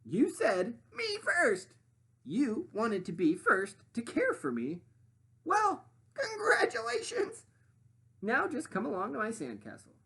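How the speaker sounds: high, loud demanding sandwich voice; high bossy sandwich lady voice. Acted. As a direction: play the pushy sandwich, high and grand. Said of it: "the sandwich continued".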